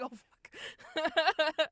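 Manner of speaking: high, loud laughed curse; laughing mock dismay. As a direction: re-enact dismay while breaking into laughter. Acting out own dismayed reaction.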